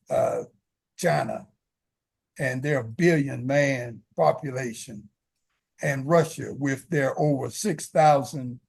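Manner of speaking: normal talking voice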